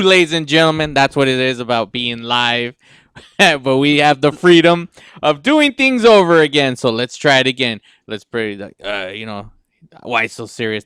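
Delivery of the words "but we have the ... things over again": usual speaking voice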